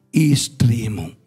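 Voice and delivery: ordinary delivery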